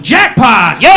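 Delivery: gleeful hammed-up cheer; mock jackpot yell. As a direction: shout it like you hit the jackpot. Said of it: "with a brain tumor yelling".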